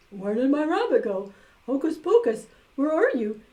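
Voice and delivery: loud calling for a lost rabbit; loud high searching call. A narrator's reading. Magician calls for lost rabbit.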